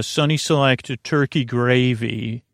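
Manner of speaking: normal talking voice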